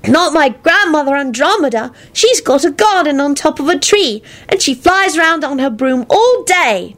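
put-on high, chirpy little boy; cheery high child voice; cheerful chirp. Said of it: "Teddy chirped cheerfully".

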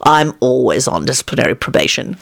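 low-pitched, easy reassurance; consoling. A narrator's reading. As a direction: console him gently, voice dropped low. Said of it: "told him in a consoling tone".

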